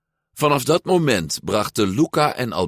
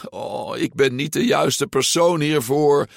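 higher-pitched, whining protest; complaining; 7 semitones higher than usual. A narrator's reading. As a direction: moan that you're the wrong one for this. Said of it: "klaagde Luca". (klagend, met hoge stem)

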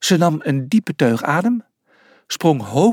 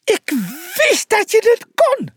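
very high, tiny character voice; high-pitched jubilant cry; overjoyed squeal. A narrator's reading. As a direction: squeal it with sheer joy. Pliny jumping with joy.

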